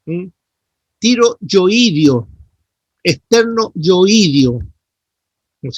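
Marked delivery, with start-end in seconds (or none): 1.0-2.3 Tiro yoidio, slow and louder, each syllable clear; teacher drilling the term slowly; correct stress. Shown as a demonstration. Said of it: "Esa es la forma como acentúan los músculos". 3.0-4.6 Tiro yoidio, slow and louder, each syllable clear; teacher drilling the term slowly; correct stress. Shown as a demonstration. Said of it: "Esa es la forma como acentúan los músculos".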